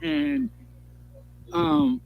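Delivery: ordinary delivery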